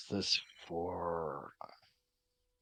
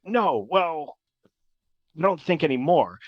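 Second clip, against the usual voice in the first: mildly exasperated, louder and higher start; a little frustrated; 3 semitones higher, 6 dB louder than usual. Acted. Winston corrects the crew about immortality, a little frustrated. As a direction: correct them with a touch of frustration. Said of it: "kinda get a little frustrated".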